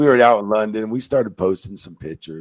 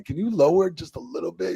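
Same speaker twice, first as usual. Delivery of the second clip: pleading request; 10 semitones higher than usual. Retold or imitated. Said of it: "I'm like".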